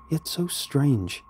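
plain reading voice

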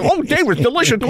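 high, hammed-up relish; over-the-top delighted insistence; gleeful food rave. Answers appalled Dave in acted scene.

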